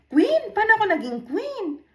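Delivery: high, fast, disbelieving cry of "Queen?"; be floored at being called a queen; shocked disbelief. Retold or imitated.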